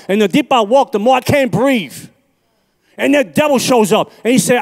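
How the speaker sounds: high, loud fire-and-brimstone preaching, climbing as it goes; full-force preaching. Acted. Preacher warning the congregation about hell, in full-force preaching. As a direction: preach it to the rafters, voice ringing.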